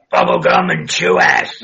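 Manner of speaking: deep, pitch-shifted wrestler voice. Retold or imitated. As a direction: growl it through a voice changer. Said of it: "the voice of Roddy Piper".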